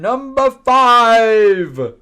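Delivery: game-show countdown voice; announcer call. Real, not acted. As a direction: boom out the number like a show host. Countdown host announcing next entry.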